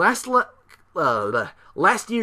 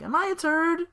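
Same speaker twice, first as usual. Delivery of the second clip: high-pitched mock question to the crowd; mock Bryan asking. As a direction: ask the fans in a high put-on voice. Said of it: "Daniel Bryan's like".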